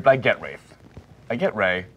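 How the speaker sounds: usual speaking voice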